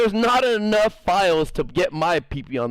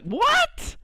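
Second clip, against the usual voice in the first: shocked what. Retold or imitated. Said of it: "It's like".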